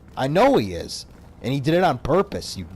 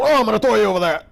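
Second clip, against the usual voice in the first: high, fast, loud goofy game voice; mocking a badly dubbed fighting game character; strange game grunt voice; 7 semitones higher, 7 dB louder, much faster than usual. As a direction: mimic a silly fighter throw sound. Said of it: "they would make the strangest sounds".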